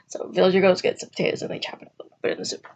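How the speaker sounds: normal talking voice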